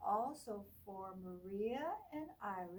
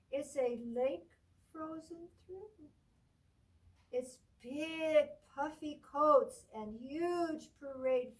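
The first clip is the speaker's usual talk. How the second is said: bright, lively storybook read, higher and louder; reading to children; 4 semitones higher, 10 dB louder, slower than usual. Acted. Teacher reading a Christmas book to children, lively storybook voice. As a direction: read it out like a picture book for a class.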